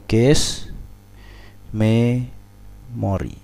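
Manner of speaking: "Cache memory" drawn out slowly while writing; slow dictation. Shown as a demonstration. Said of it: "tulisannya itu gini". (mendikte "Cache memory" pelan-pelan)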